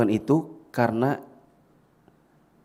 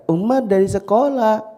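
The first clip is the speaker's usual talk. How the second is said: put-on high, loud, slow child voice; Umar; 11 semitones higher, 5 dB louder, slower than usual. Small boy's earlier answer, loud and high. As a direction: call it out like a little kid. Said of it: "Jadi yang tadinya dia cerita".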